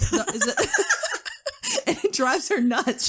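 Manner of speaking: high laugh breaking through. Real, not acted. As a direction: giggle it out. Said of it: "laughing at mom".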